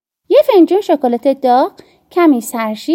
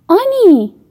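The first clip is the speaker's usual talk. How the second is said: sharp call; 7 semitones higher, 3 dB louder, much slower than usual. A narrator's reading. Mother calls daughter sharply.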